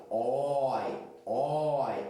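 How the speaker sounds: "oi" said with a wide-open mouth; open diphthong shown. Shown as a demonstration. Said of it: "olha como a boca". ("oi" aberto, boca bem aberta)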